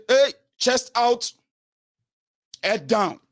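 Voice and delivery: slow, high-pitched barked orders; drawn-out parade-ground command; drill commander. Acted. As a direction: drill them like a stern master. Acting a master drilling subordinates.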